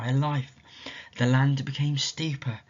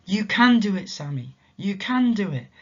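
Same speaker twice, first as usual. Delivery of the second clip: higher, coaxing voice; gentle encouragement; 8 semitones higher, 4 dB louder than usual. Acted. Mysterious voice urging tired Sammy, gently encouraging. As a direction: urge him on kindly.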